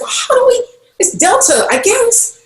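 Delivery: very high, flustered mock reply; retold flustered answer. Passenger answering the driver's question, flustered and high-pitched. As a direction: imitate being lost, voice shooting up high.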